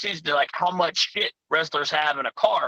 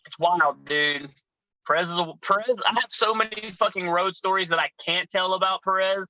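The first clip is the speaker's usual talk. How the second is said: ordinary talk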